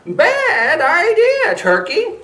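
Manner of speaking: very high, loud, slow rooster voice. Acted. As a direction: squawk a warning like a rooster. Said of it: "rooster speaking".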